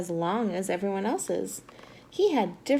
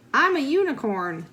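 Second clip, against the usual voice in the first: rhino voice; 2 semitones higher, 3 dB louder than usual. Acted. Rhino says it's a unicorn.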